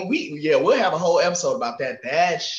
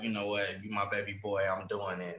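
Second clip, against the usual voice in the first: mom's voice; 7 semitones lower, 11 dB quieter than usual. Retold or imitated. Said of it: "she was like".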